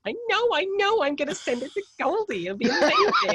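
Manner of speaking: very high, loud mock excitement; over-excited gushing. Producer telling friends about the tune, hammed-up squeal. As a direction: squeal it, breaking into laughter.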